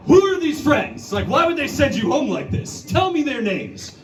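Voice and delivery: high, demanding mock parent; interrogating parent. Comedian scolding his mother like a parent, demanding. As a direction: grill her like a worried dad. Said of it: "I went".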